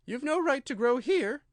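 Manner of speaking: a small creature griping; complaining Dormouse. A narrator's reading.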